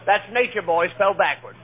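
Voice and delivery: usual speaking voice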